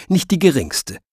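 ordinary delivery, nothing marked

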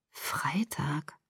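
whispered, low echo of "Freitag"; dazed whisper. Acted. Daughter realizing how long she slept, dazed whisper. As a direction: breathe "Freitag" to yourself, barely voiced.

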